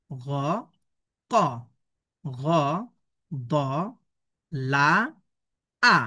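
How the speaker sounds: isolated letter sounds, "বা", "না", "মা"; deliberate Arabic pronunciation drill; slow syllable reading. Shown as a demonstration. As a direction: leave a long pause after every syllable. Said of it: "আমি প্রথমে ধীরে ধীরে আমি পড়ছি".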